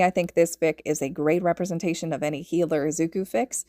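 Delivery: ordinary delivery, nothing marked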